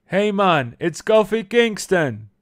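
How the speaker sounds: slow hammed-up Jamaican accent greeting. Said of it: "Kofi Kingston would be like".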